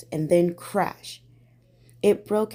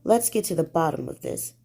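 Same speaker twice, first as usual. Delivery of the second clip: ordinary delivery, nothing marked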